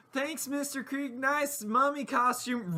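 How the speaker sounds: put-on high-pitched little kid voice; put-on child's voice, high throughout; little girl. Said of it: "that little girl says".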